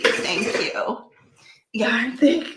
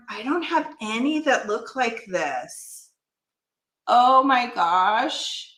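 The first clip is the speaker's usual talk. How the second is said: ordinary talk, nothing marked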